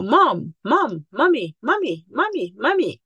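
put-on a kid pestering mom, pitch up; child calling mom. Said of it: "No, they go".

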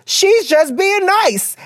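high and slow, spelling it out; she's just being nice, dragged out high; pointed explanation. Acted.

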